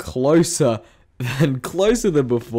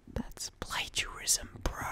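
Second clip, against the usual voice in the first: soft mocking whisper aside; 18 dB quieter than usual. Real, not acted. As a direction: murmur it under your breath.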